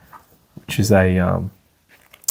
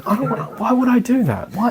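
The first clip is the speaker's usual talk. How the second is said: much higher, incredulous protest; indignant self-talk; 12 semitones higher than usual. Pretend. Teacher acting out refusing his tempting mind, much higher and incredulous. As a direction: recoil at the idea, squeaking with disbelief.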